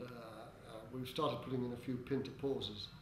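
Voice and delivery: normal talking voice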